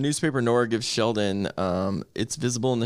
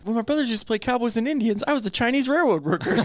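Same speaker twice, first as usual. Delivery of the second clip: much higher-pitched put-on voice; high comic quote; quoted movie character; 11 semitones higher than usual. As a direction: deliver the line high, in character. Acts out a movie line.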